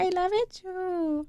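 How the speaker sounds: high, slow, breathy put-on voice; playful cartoon voice; silly high voice. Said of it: "Okay, voice".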